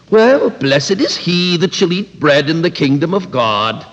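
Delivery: a touch of smug piety, lightly voiced; mildly sanctimonious, as if showing off his faith; lightly mocking a pious guest. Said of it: "It was said in that tone of voice".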